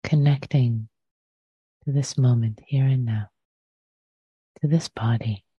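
unhurried meditation guidance with long pauses; guided meditation. Real, not acted. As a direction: guide listeners into the breath, leaving space after each phrase.

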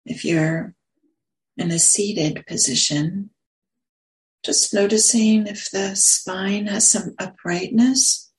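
unhurried meditation guidance with long pauses; guided meditation. Real, not acted. Meditation teacher settling listeners into posture and breath, guided meditation. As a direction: ease them gently into stillness, pausing between phrases.

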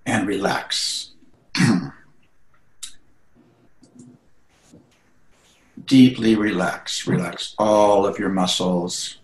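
unhurried meditation guidance with long pauses; guided meditation. Acted. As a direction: guide listeners gently into relaxation, phrase by phrase.

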